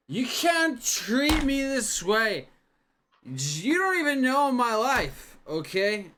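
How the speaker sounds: put-on high, slow, loud drunken whine; slurring drunk protesting loudly; whiny drunk. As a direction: wail it like a sloppy drunk. Drunk man whining at crowd.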